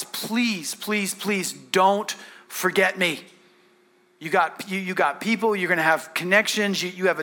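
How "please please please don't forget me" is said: slow, drawn-out plea; beg, stretching each word out; pleading. Acted. Joseph begging the cupbearer not to forget him, pleading slowly.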